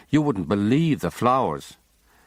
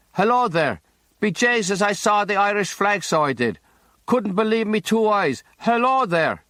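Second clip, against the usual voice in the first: much higher, hearty Irish hail from below; Irish calling up; 11 semitones higher than usual. A narrator's reading. Boatman greeting the Irish ship from his boat, high and hearty. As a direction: call up to the ship in a lilting Irish brogue. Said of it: "an Irish voice calls up".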